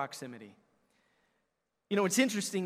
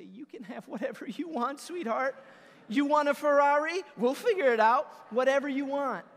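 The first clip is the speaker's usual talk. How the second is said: high-pitched gushing, building louder; melt, then promise her the world; doting, smitten dad; 7 semitones higher, 5 dB louder than usual. Retold or imitated. Father giving in to his daughter, high comic gushing.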